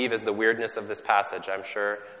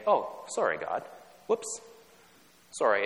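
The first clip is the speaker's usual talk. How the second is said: mock polite apology. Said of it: "Canadian repentance, which is just saying".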